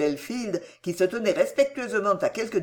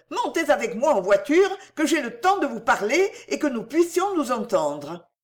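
higher, urgent feminine appeal; exclaimed appeal; 6 semitones higher than usual. A narrator's reading. Cécile asking Delville into the carriage to talk, urgently. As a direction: press him in a raised pitch. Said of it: "s'écria Cécile".

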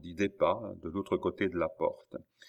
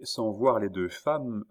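ordinary delivery, nothing marked

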